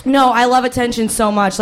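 usual speaking voice

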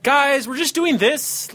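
high, slow carefree rich-guy voice; breezy like nothing can hurt you. Shown as a demonstration. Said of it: "that's my rich voice".

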